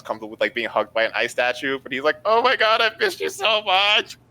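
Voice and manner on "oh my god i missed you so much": high, loud, excited squeal; gushing reunion. Acted. Player as character hugging Freed after absence, gushing. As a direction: gush it as if hugging a lost friend. Acted reunion with massive hugs.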